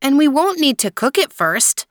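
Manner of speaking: put-on a small boy insisting he knows; eager child. A narrator's reading. Son pleading with his father the emperor, high eager child voice.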